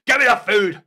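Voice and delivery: mock chauvinist yelling for dinner; mock bossy husband. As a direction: play a bossy husband demanding his food, ending in a shout. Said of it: "I wasn't saying".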